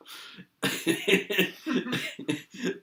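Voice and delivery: high airy giggle with no voice; breathy silent laugh. Real, not acted. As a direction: wheeze out a laugh. Rit de l'histoire. (rire soufflé sans voix)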